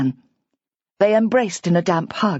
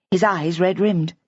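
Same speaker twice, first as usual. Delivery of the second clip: ordinary delivery, nothing marked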